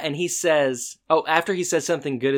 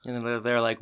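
usual speaking voice

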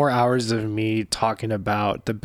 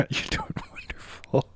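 laughing whisper; 8 dB quieter than usual. Real, not acted. Teasing reassurance through laughter.

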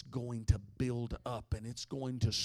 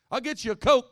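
higher, louder pushy hostess; eager host voice; 12 semitones higher, 14 dB louder than usual. Retold or imitated. Host pressing a drink on the pastor, in an eager high voice. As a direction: offer the Coke brightly, insisting.

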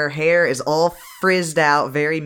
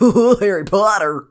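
louder, higher mock fan gush; mocking people who compare every boarding school to Harry Potter; 6 semitones higher, 7 dB louder than usual. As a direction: gush like a fan spotting something. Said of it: "be like".

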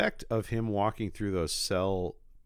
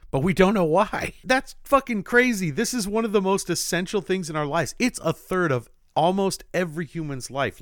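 much higher and louder, laughing in disbelief; amazed laughter; 10 semitones higher, 6 dB louder than usual. Real, not acted. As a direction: marvel out loud at something crazy. Amazed by sleep fact.

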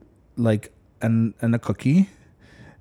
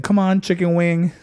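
mock yell; 6 semitones higher than usual. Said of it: "he starts yelling".

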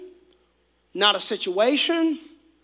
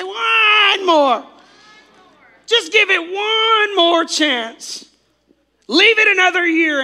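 high, slow drawn-out whine; whiny voice; 7 semitones higher, 5 dB louder, slower than usual. Pretend. Congregation whining like kids begging for one more chance. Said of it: "Can we just say that again? Say".

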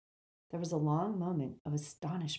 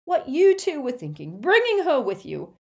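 high, loud scolding; mother shouting; 11 semitones higher, 9 dB louder than usual. A narrator's reading. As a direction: scold the twins at full voice. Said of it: "shouted Missus Weasley".

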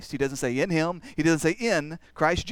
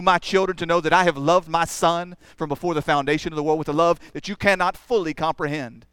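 ordinary talk, nothing marked

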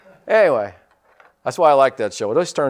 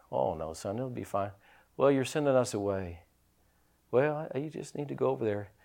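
softer hushed exchange between father and son; quiet father; 8 dB quieter than usual. Retold or imitated.

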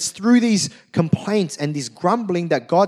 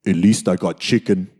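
much lower, flat excuse; deadpan Leroy; 10 semitones lower than usual. Retold or imitated. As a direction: drop the voice low for the punchline. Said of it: "you hear Leroy go".

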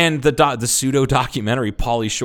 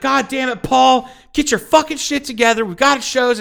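very high imitation of a scolding mother; yelling mom; 4 dB louder than usual. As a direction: holler at your son down the hallway. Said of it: "she's yelling at him".

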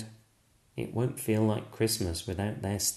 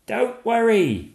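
much higher and louder reassuring call; eager call; 13 semitones higher, 8 dB louder than usual. Acted. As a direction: call up the chimney to help. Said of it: "cries Stickman".

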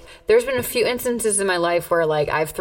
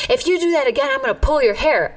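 higher-pitched mock threat; retold threat; 6 semitones higher than usual. Girl warning a sandwich dancer, higher imitated threat. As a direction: imitate an offended girl's warning.

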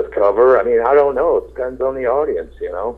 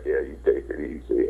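imitating Clint Eastwood; 7 semitones lower, 11 dB quieter than usual. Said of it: "He's like".

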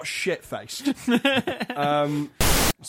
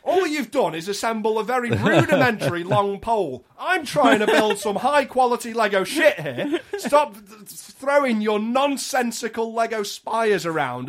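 raised, loud indignant tirade; mock insulting rant; 6 semitones higher, 5 dB louder than usual. Small boy berating Lego playmates as they hit him, mock furious rant. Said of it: "very talented at insulting people".

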